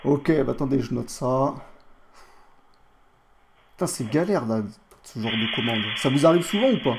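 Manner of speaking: grumbling fake rebeu French accent; put-on rebeu accent. Customer grumbling about the botched order, put-on rebeu accent. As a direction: complain along in a mock rebeu accent. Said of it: "mon accent".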